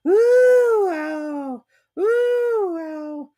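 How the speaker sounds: high, loud, squeaky little yelps; squeaky Piglet yelps. A narrator's reading. Piglet yelping all the way into the house. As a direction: yelp high like a tiny frightened pig. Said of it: "he was saying".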